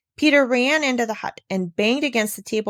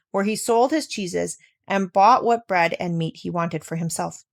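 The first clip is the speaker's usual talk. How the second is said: plain reading voice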